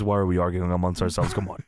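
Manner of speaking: low monotone mock quote; imitating a calm, monotone wrestler. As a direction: drop low and hold it on one note. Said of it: "in a very monotone voice".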